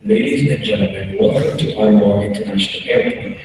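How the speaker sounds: low, formal captain's cabin announcement; pilot announcement. Retold or imitated. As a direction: read it like the pilot on the intercom. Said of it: "and the voice goes".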